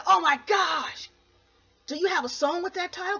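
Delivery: record execs thrilled by her title; excited gasp. Retold or imitated. Record execs gush at Katie, thrilled and loud.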